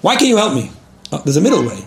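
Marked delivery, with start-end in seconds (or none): 0.0-0.7 higher, louder complaint; wife loses patience and snaps at her husband; mock exasperated demand. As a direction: snap at the husband in jest. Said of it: "you know what I'm just gonna say".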